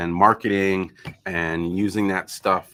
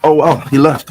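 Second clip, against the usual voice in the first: surprised; 7 semitones higher, 11 dB louder than usual. Real, not acted. Stream viewer suddenly gone.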